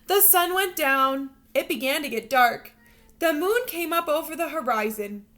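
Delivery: expressive read-aloud storytelling voice; storybook narration. Acted. Teacher reading a picture book to her students, lively storytelling voice. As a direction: read it like a bedtime picture book for little kids.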